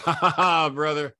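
gloating laugh. Retold or imitated. Flair gloating while paying, retold.

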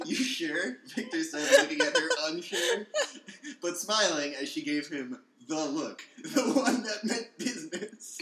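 giggly reading; reading through laughter; laughing while reading. Real, not acted.

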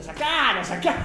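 high, loud laughing order; mock gleeful urging; laughing command. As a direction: egg on the bouncer, laughing. Duque urging to remove him.

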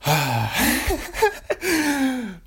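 long loud sigh sliding down from very high; loud exasperated sigh. Real, not acted. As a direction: let out a big fed-up sigh. Exasperated at own name repetition.

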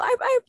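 high yappy little dog barks; imitated dog barking. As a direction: bark like a small dog for him. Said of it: "tahol ka daw".

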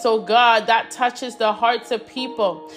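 ordinary talk, nothing marked

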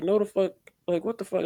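high, loud baffled reaction; indignant disbelief. Retold or imitated. Retelling how she rolled her eyes, indignant. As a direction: pitch it way up, totally baffled.

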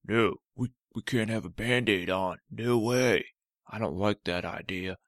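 a cranky toe refusing the Band-Aid; stubborn talking toe. Acted. Cut toe protesting to Olivia, loud and grumpy.

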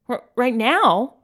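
stuttered, halting "R-, right now"; stammering. A narrator's reading. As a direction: trip over the first word in surprise. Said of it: "Ken stammers".

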